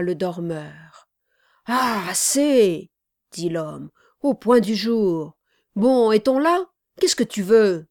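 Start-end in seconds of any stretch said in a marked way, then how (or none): 1.7-2.9 loud, drawn-out, higher protest; groggy sleeper's loud, slow complaint; irritated protest. A narrator's reading. Sleeper shaken awake by Charlot, irritated protest. 4.2-7.9 loud, annoyed questioning; irritated. A narrator's reading. Woken man asking Charlot what he wants, irritated.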